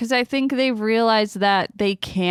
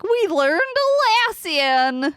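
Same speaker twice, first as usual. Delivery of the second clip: put-on high, childlike lilt; high sing-song voice; 11 semitones higher than usual. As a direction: chirp it like a cartoon hero. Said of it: "they were like".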